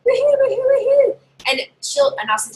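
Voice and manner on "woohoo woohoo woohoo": high, loud cheering woohoos; cheerleader woohoo. Shown as a demonstration. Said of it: "I want you to be like".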